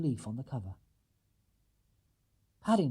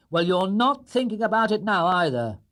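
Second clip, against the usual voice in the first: firm, raised and louder father's rebuke; stern dad, higher and louder; 6 semitones higher, 6 dB louder than usual. Acted. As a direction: tell the bear off sternly. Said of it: "replied Mister Brown sternly".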